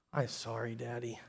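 put-on very soft, small toddler apology; Landon. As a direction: imitate a little boy meekly saying sorry. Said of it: "And Landon said".

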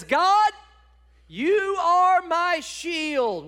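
high, loud and very slow cry to God; a high, loud plea, stretched slowly; fervent prayer. Acted. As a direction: call out to God, drawing each word out. Preacher voicing David's prayer.

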